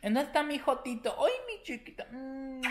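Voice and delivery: high cooing pet voice. Real, not acted. Cooing at his little dog, high baby talk.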